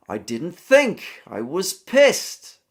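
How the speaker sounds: loud, slow American accent, every word clear; angry in an American accent, all words sounded. Shown as a demonstration. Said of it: "In an American accent".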